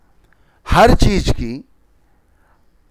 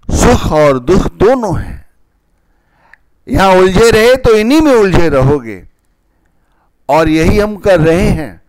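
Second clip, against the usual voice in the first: raised preaching voice; wise mentor; 5 semitones higher, 4 dB louder than usual. Acted. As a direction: exhort the congregation, louder and higher.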